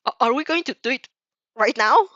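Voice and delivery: loud, astonished stammer; shocked. A narrator's reading. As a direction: ask it startled, rising at the end. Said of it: "suddenly shocked".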